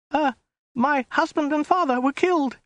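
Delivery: very high falsetto woman's voice; frightened woman. A narrator's reading. Captive woman telling Khan her day, frightened falsetto.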